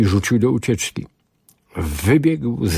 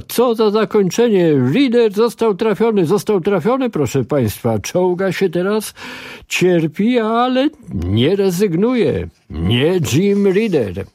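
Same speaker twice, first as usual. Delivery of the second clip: Mike Terry; 10 semitones higher than usual. Acted. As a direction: hype it like a live game-show commentator. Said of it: "przemawiał głosem Mike'a Terego".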